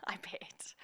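whispered, laughing aside. Real, not acted. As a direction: drop to a private whisper.

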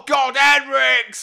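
slow, high, loud exasperated yell; exasperated shout. Pretend. Owner giving up on the dog, shouting. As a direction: bellow the dog's name in despair.

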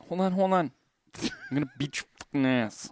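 squeaky angry threat; high mock threat. Acted chase scene.

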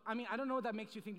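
normal talking voice

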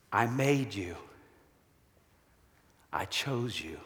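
softly voicing God to the listener; hushed. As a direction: lay each phrase down gently. Closing appeal voicing God.

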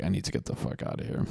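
hushed and fast, breathy panic; quiet mock panic in a stranger's kitchen; panicked whisper. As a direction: whisper it like you need to escape. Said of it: "I'm just like".

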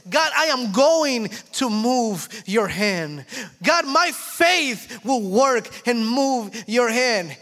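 slow, loud, determined prayer; bold prayer. Retold or imitated. Believer tells God faith will move his hand, slow and loud. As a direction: claim it slowly to God. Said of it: "faith prayer quoted".

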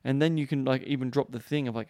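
ordinary talk, nothing marked

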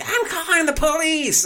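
shrieked threat in a very high, loud voice; mock screaming. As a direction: imitate a hysterical woman screeching. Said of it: "screaming at me".

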